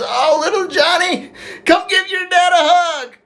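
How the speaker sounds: high, slow, loud emotional outcry; overjoyed shout. Acted. Mailman greeting his secret son, overjoyed drawn-out shout. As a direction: cry it out with arms-open joy.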